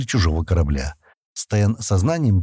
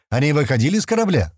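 higher-pitched, mildly projected question; slightly raised, insistent asking; 7 semitones higher than usual. A narrator's reading. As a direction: call the question out. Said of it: "громко спросил Стэн".

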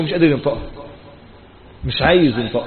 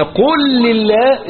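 drawn-out emphasis; 5 semitones higher, much slower than usual. Shown as a demonstration.

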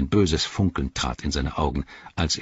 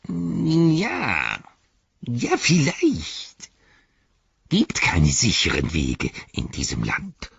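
sulky; 8 semitones higher than usual. A narrator's reading. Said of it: "sagte Gollum mürrisch".

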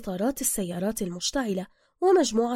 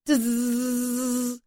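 very long electric zzz; taser buzz; 3 semitones higher, much slower than usual. A narrator's reading. Narrator imitates the taser striking, drawn-out buzz. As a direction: hold the buzz like a shaver. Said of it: "الشبيه بصوت ماكينة الحلاقة الكهربائية".

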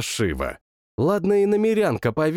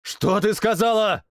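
mild growl, higher and sharp; 7 semitones higher than usual. Acted. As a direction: growl a demand. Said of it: "рыкнул я".

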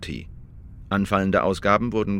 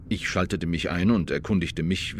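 ordinary delivery

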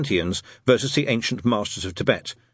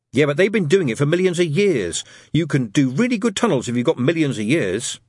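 put-on higher, emphatic boy's retort; Adam, insistent schoolboy; 5 semitones higher, 4 dB louder than usual. Boy defends his tunnel theory to friends, insistently. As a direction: push back hard on the objection.

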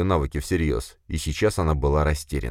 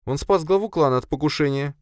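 much higher female-character voice; heroine, objecting; 11 semitones higher than usual. Acted. Heroine defends the accused to boss, objecting. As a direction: counter him in a high, steady voice.

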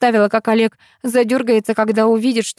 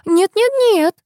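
high, quick insistent refusal; protesting; 11 semitones higher than usual. Acted. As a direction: argue it off brightly. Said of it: "заспорила Элла".

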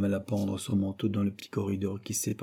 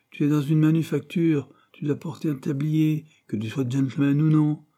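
higher, louder rebuke; firm rebuke; 6 semitones higher, 6 dB louder than usual. Acted. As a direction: put the boy in his place. Manager rebuking Cyril.

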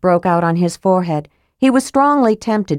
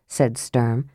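ordinary delivery

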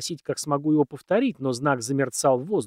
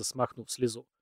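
ordinary delivery

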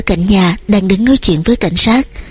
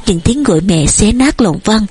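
plain reading voice

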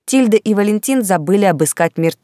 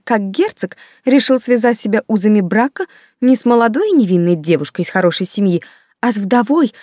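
high-pitched, scandalised society gossip; affronted, emphatic rant; indignant; 6 semitones higher, 3 dB louder than usual. A narrator's reading. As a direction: complain in outrage, voice riding high. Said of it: "возмущённо подтвердила Ханна".

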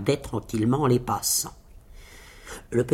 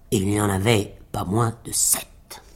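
plain reading voice

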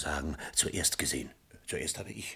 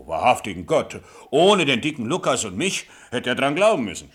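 much louder, higher boasting exclamation; excited boast; 6 semitones higher, 12 dB louder than usual. Acted. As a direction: crow about the rescue. Bauer prahlt mit der Rettung. (laut und aufgeregt prahlend)

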